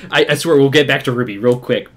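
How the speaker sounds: normal talking voice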